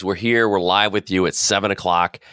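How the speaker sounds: normal talking voice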